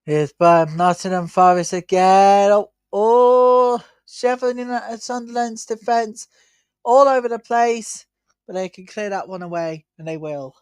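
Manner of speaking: brisk live match call; play-by-play commentary. Acted. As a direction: call the action as it happens.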